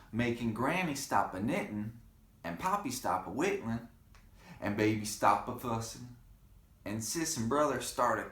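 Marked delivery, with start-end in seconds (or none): none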